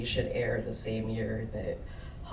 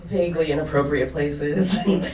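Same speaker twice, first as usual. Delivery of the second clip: words breaking into loud laughter; laughing; 2 semitones higher, 8 dB louder than usual. Real, not acted. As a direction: laugh through it, louder and louder.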